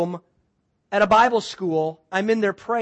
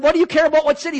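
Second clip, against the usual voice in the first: exasperated; 8 semitones higher, 8 dB louder, faster than usual. Retold or imitated. Retold exasperated exchange.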